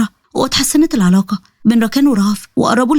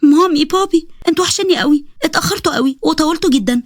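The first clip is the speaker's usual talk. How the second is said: put-on high, happy child greeting parents; thrilled, high-pitched greeting; joyful; 6 semitones higher than usual. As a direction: squeal a delighted welcome home. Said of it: "نزلت تجري بفرحه".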